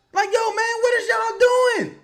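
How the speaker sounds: high, loud coach's chewing-out; Borrego. Acted. Coach cursing players out in a timeout, furious shout. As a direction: shout at your players in angry disbelief. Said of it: "Borrego should be calling a timeout right now and cursing these motherfuckers out".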